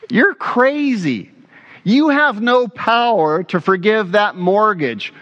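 high, drawn-out "crazy", then insistent; acted disbelief. Homeowners rejecting the pastor's offer, mildly incredulous and high.

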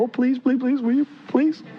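high, pleading suitor begging on his knees; groveling plea. Retold or imitated. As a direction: beg like a nervous man proposing. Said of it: "you get down and you grovel".

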